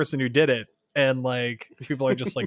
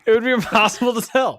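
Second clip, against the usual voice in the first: higher-pitched, breaking into a real laugh; 7 semitones higher than usual. Real, not acted. Co-host riffing on a villain's careless answer, laughing. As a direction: say it through laughter.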